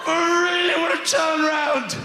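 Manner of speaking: a TV longing to be faced; lonely television. Pretend. TV at the side of the room, pleading wistfully. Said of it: "television will be on the side of the room going".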